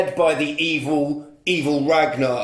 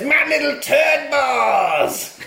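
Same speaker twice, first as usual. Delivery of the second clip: hammed-up villain, high, loud and slow; mock evil villain cackling the line; 11 semitones higher, 4 dB louder, much slower than usual. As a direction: camp villain gloating through a laugh. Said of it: "And he says".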